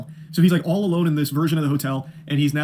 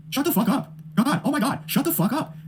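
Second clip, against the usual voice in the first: higher-pitched, bored parody of a flat delivery; unenthusiastic mock line reading; 9 semitones higher than usual. Mocking [name]'s viral rant, unenthusiastic and higher. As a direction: imitate a lazy first-take read, half laughing. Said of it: "in the most unenthusiastic tone ever".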